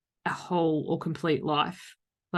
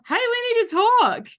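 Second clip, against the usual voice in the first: much higher, louder, bright and eager; cheery we need to talk; 7 dB louder than usual. Shown as a demonstration. Showing how a text can read as friendly, high and eager. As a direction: sing it out like good news. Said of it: "the words are exactly the same".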